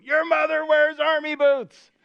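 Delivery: high, loud mock Hulk taunt, half laughing; Hulk shouting. Preacher voicing an angry Hulk yelling up at the window, a hammed-up shout. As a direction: yell the insult up like a raging Hulk, with a laugh breaking through. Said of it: "he shouts up at you".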